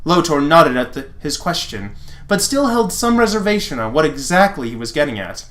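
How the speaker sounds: ordinary talk, nothing marked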